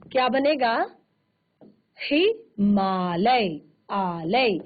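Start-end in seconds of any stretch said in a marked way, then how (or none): 2.0-3.6 हिमालय drawn out slowly with a long आ; slow joined word. Shown as a demonstration. Teacher slowly saying the joined word. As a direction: stretch the आ in हिमालय. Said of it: "joined word demo".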